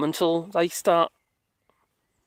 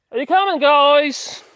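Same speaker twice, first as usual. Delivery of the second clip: calling out; 7 semitones higher, 6 dB louder, slower than usual. Real, not acted. Calling the dogs from afar.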